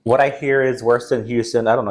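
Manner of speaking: normal talking voice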